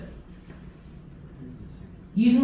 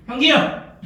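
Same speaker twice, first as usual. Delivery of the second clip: loud, higher-pitched name call; teacher's raised-voice summons; calling a student; 4 semitones higher, 7 dB louder than usual. Real, not acted. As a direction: call sharply across the classroom.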